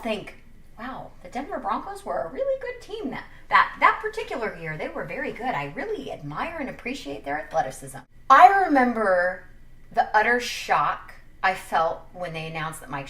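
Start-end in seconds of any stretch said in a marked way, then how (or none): 0.8-8.0 sing-song pretend praise; mock admiration. Browns fans supposedly praising the Broncos, mock admiring sing-song.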